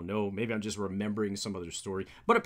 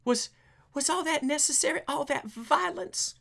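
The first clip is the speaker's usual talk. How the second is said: high doctor voice. Acted. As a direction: ask it high like a shocked doctor. Voicing a doctor.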